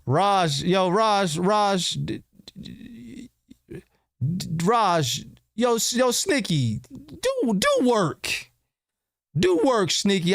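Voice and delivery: high-pitched hype, cheering a friend on; excited calling out. Real, not acted. Streamer cheers on Raj mid-game, high excited calling.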